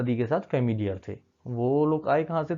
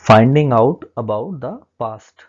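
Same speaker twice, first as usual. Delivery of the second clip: slow heading read; 12 dB louder, much slower than usual. Acted. Teacher reads chapter heading.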